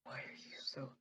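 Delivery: hushed, whispered complaint; whispering. Acted. Player scolds noisy party near the camp, whispering. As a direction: scold under the breath in a whisper. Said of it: "trying to whisper and stuff".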